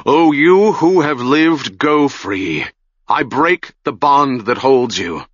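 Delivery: the mage declaiming to the silent crowd; said aloud. A narrator's reading. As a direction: release the dead with a ringing command. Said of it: "said aloud".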